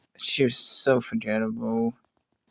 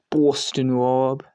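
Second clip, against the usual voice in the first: slow, drawn-out Boston accent; much slower than usual. Shown as a demonstration. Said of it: "I did a Boston accent".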